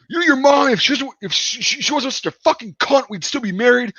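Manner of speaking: high, angry mock screaming; mimicked screaming. Dad ranting at his son about the mother, mimicked screaming. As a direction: tear into him in an imitated high-pitched rant. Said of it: "he starts screaming at my friend".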